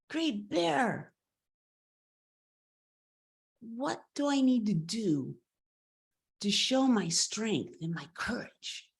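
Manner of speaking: a hint of an earnest young petitioner; boy calling out. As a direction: raise the pitch to hail someone mighty. Boy calls out to great bear.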